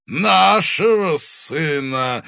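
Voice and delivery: loud, slow, high triumphant boom; triumphant thunder. Acted. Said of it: "торжествующе прогрохотал".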